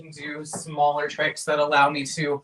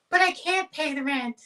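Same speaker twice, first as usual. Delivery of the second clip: damsel voice; 11 semitones higher than usual. Acted. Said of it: "the classic".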